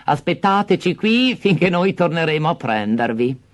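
high, honeyed false sweetness; fake-kind stepmother. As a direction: pretend to care. Stepmother fakes kindness.

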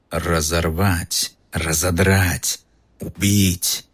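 a hungry monster hissing in the walls; icy murderous voice. Acted. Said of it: "тот же ледяной, убийственный голос".

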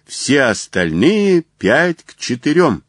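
high-pitched racecourse odds calls; shouts from the stands. A narrator's reading. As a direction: call each bet out to the crowd. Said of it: "неслось с трибун".

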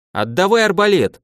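mock-strict command; stern demand. A narrator's reading. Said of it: "строгим голосом произнёс Фил".

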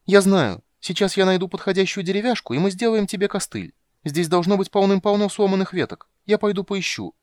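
high, loud eager chatter. Acted. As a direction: burst out with the idea. Kender eagerly offers a crutch. (оживлённо)

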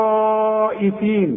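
إطالة الألف قبل الهمزة في «للطائفين»; مد الألف طويلًا في «للطائفين»; مد واجب. Shown as a demonstration. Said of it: "لازم تمد". («للطائفين» بمد واجب ممطوط)

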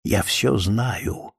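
a menacing hissed whisper, slowing; angry hiss. A narrator's reading. The witch silences the narrator with an angry hiss. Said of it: "и зло прошипела".

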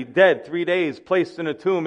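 ordinary talk

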